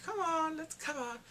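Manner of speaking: taunting villain. Retold or imitated.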